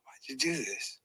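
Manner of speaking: whispered, hopeless question to God; despairing whisper. Retold or imitated. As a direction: breathe it out broken. Alone in the locker room.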